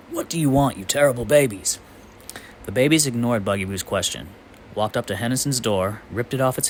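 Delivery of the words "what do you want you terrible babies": demanding. A narrator's reading.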